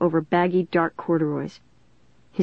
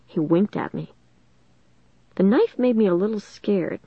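usual speaking voice

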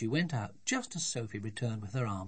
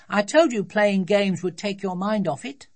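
put-on high, loud child's voice; childish know-it-all; smug little daughter; 9 semitones higher, 11 dB louder than usual. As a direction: lecture daddy triumphantly as a small girl.

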